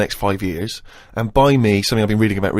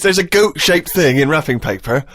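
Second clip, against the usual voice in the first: mock wide-eyed wonder; 8 semitones higher, 4 dB louder than usual. Mocking the Christmas goat gift with fake surprise. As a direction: ham up a child's delight at an obvious present.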